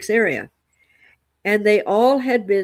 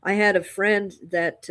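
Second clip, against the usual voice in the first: normal talking voice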